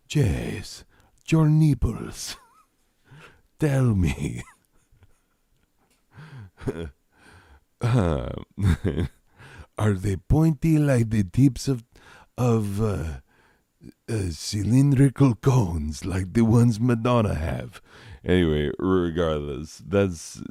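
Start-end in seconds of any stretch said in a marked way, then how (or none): 0.1-4.4 hammed-up Spanish accent on the edge of laughing; put-on Spanish accent. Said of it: "why would I have this accent". 9.8-17.8 hammed-up Spanish accent, inquisitive; playful Spanish-accented questioning; put-on Spanish accent. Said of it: "why would I have this accent".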